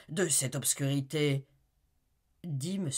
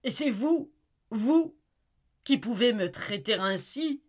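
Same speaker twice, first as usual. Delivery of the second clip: much higher, loud, outraged protest; indignant disbelief; 9 semitones higher, 8 dB louder than usual. Acted. Wife Amy answering her husband's accusation, with stunned indignation. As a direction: throw his hypocrisy back at him.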